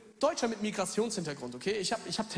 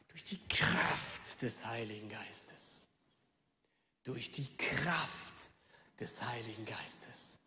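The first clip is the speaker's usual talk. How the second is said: hushed, breathless whisper, much softer and lower; reverent whisper; 8 semitones lower, 7 dB quieter, much slower than usual. Acted. Pastor closing the verse for his congregation, reverent whisper. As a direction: let the words fall to a whisper. Reverent close of Bible reading.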